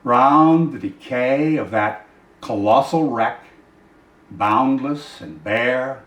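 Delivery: very slow, drawn-out poetic close; poem recitation. Acted. Reader closing Shelley's poem on empty sands, slow and fading.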